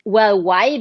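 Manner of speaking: usual speaking voice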